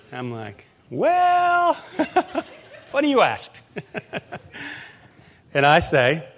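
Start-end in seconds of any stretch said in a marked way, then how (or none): 0.9-3.7 high, laughing playful answer; laughing reply. Retold or imitated. Retelling his reply to golfer.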